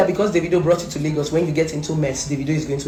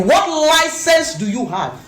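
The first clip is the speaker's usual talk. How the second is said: incredulous; 10 semitones higher, slower than usual. Real, not acted. Ranting at a rival vlogger.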